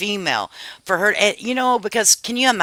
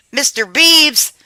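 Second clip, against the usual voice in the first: parrot-teaching voice; 9 semitones higher, 6 dB louder than usual. Pretend. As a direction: drill the words for a parrot.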